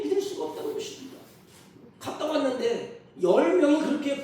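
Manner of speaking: loud, high-pitched pulpit exhortation; impassioned preaching. Real, not acted. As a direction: cry out the questions to the congregation. Preaches at full force.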